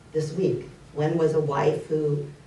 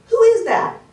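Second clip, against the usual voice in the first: high, loud call into the forest; acted child shouting to a stranger; boy calling out; 9 dB louder than usual. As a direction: call out a startled challenge, much higher. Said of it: "the little boy falls".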